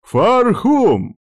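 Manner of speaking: calling out loudly across the yard; loud shout. Acted. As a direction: shout the name high and loud. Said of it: "громко крикнул".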